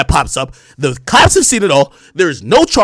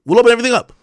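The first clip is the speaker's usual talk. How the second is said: louder and higher, leaning hard on open; emphatic repeat; 6 semitones higher, 5 dB louder, much faster than usual. Real, not acted. Repeating his lawyer's offer to chat, loud and emphatic. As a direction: hammer home the lawyer's promise.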